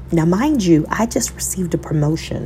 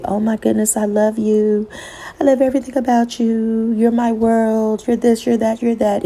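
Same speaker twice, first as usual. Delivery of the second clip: imitating a smitten honeymoon spouse, higher and lighter; gushing newlywed; 6 semitones higher than usual. Mimicking a smitten spouse in the honeymoon phase, gushing. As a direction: gush like you're freshly in love. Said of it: "in the honeymoon phase to say".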